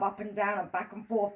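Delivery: ordinary delivery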